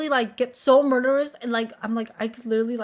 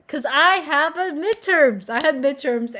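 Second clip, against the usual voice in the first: loud, high and drawn out; exasperated mock outburst; 3 semitones higher, 5 dB louder, slower than usual. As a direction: belt out the excuse in slow exasperation. Mock outburst giving her excuse.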